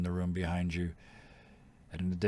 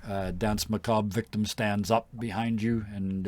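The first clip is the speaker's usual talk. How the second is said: ordinary talk, nothing marked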